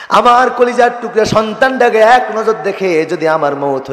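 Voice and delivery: high chanted plea of a dying mother; impassioned preaching. Acted. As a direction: preach it high, sliding down at the end.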